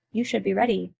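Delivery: normal talking voice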